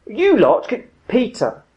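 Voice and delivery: high, loud exasperated call to Peter; imitating Jeremy's rebuke. Preacher imitates Jeremy's reading of Jesus rebuking Peter, exasperated. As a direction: mimic a reader really going for it. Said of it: "he really nails, you know, really goes for it".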